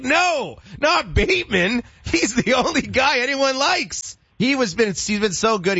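high, anguished mock protest; dismayed cry. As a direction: cry it out in pretend despair. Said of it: "You're like".

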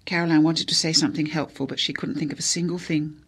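usual speaking voice

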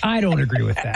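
put-on low voice, repeated deadpan; mock deep voice. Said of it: "women with deep voices".

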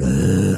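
low zombie moan; zombie groan. Acted. Imitating poll workers staring at you, low zombie groan. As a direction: groan like the living dead.

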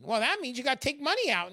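ordinary talk, nothing marked

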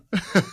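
a soft falling chuckle; chuckling after the meme joke; short quiet laugh. Real, not acted. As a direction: laugh at your own joke under your breath.

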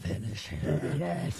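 very slow, drawn-out announcer; Mortal Kombat voice. Pretend. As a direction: stretch it like the arcade announcer. Mimicking the game announcer.

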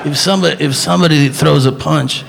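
labored instructor in a put-on voice; halting mock fight teacher, stopping and restarting; Steven Seagal. Seagal teaching people how to fight, mock labored instructor.

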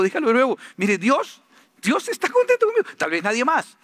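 high, driving full-force preaching; fervent preaching. Acted. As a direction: preach it to the crowd with fire.

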